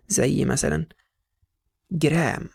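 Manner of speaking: ordinary talk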